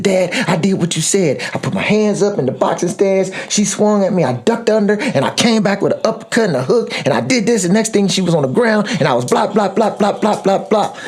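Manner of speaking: put-on high-pitched kid recounting a fight; imitated daughter. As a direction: voice an eager child giving dad the blow-by-blow. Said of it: "then Tia just told me what she did".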